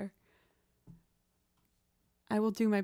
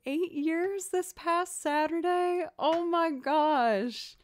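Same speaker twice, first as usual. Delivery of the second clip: high, gushing, loud blush; flustered blushing; 8 semitones higher, 5 dB louder, slower than usual. Real, not acted. Streamer hearing a viewer couple's anniversary, gushing. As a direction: gush with real delight at the news. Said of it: "How am I supposed to play this without not just blushing".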